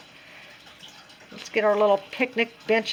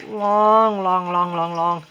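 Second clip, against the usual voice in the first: long, long, long, sinking lower as it goes; drawn-out droning; 3 semitones lower, slower than usual. Pretend. Crafter joking about a super long video, drawn-out and droning. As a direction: drag each word out, trailing downward. Mimicking a dragging, overlong video.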